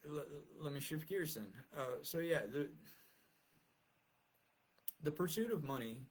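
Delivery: ordinary talk, nothing marked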